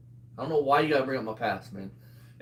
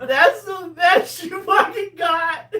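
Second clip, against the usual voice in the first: high, drawn-out, loud taunt; derisive jeer; 13 semitones higher, 5 dB louder, much slower than usual. Real, not acted. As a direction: crow it incredulously at your opponent. Mocking an opponent in banter.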